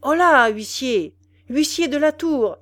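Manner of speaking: high, loud summons to a servant; calling out. A narrator's reading. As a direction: hail the usher across the tower. Officer summons the tower usher.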